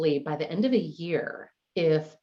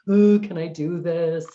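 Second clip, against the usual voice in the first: imitating a nervous client, louder and slowed down; mock anxious "can I do this"; nervous worry; 2 semitones higher, 7 dB louder than usual. Client doubting herself before decluttering, mock nervous.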